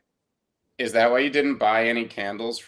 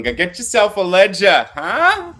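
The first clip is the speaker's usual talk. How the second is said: imitating a thick New York accent, louder and higher; mock New York tough-guy delivery; 7 semitones higher, 6 dB louder, slower than usual. Host mocks cohost's New York accent, hammed-up New York accent. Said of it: "He's got his New York accent".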